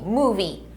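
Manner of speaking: "movie" said as moo-vee; careful teacher model of "movie"; English pronunciation. Shown as a demonstration. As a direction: say "movie" with a long oo. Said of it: "a gente pronuncia assim".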